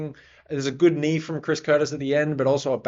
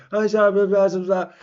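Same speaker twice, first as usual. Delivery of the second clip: loud, higher mock Portuguese babble, imitating the coach; mock Portuguese gibberish; 6 semitones higher, 5 dB louder than usual. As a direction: fake Portuguese chatter tossed off loudly. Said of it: "speaking in Portuguese".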